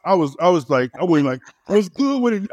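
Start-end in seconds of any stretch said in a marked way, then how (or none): 1.7-2.5 put-on high, swaggering New York accent. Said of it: "I'm on New York accent".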